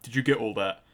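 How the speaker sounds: ordinary talk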